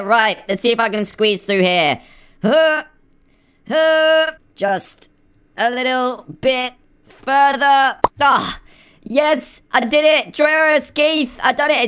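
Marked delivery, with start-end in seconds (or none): 2.4-4.3 high-pitched effort noises; play-acted pushing grunts; straining grunts. Squeezing through drain. 4.6-8.6 slow, high, effortful push; play-acted squeezing effort; straining to squeeze through. 9.0-11.9 high-pitched victory cry; play-acted jubilation; triumphant. Made it through the drain.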